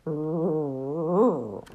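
a proud little toy-bear growl. A narrator's reading. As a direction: rumble it proudly like a teddy bear. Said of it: "he said proudly".

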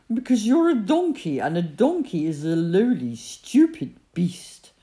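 loud, haughty camel putting someone down. A narrator's reading. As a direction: look down on the donkey, dragging out the final insult. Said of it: "answered the camel haughtily".

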